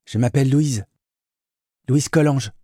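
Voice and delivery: voix de jeune fille, bien plus aiguë; voix juvénile. Acted. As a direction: une adolescente sûre d'elle. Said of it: "La voix juvénile". (voix juvénile et assurée)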